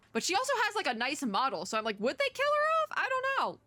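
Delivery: high excited squeal; gushing, pitch way up. Real, not acted. Gushing over character's model.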